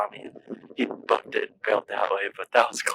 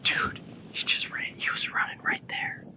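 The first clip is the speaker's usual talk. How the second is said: whispering; 5 dB quieter than usual. Real, not acted. Hunters near game, hushed.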